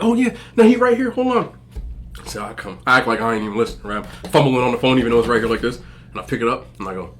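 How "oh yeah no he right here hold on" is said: mock high-pitched excited cousin. Cousin handing over the phone, high mock voice.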